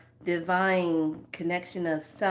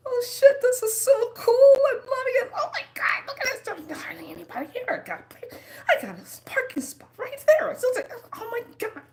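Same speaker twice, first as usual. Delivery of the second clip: much higher, squealing with delight and laughter; excited inner voice; 4 dB louder, faster than usual. Pretend. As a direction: gush like a thrilled shopper. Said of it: "you're saying".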